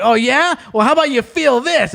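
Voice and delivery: high, indignant mock challenge; mock tough gamer. VR user striking back at the troll, high indignant mock. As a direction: puff up and hit back.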